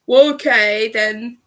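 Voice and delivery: loud sarcastic retort; retold rude, sarcastic concession. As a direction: imitate yourself being sarcastic, leaning loudly on the start. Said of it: "I was a little bit sarcastic and".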